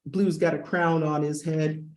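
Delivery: ordinary talk, nothing marked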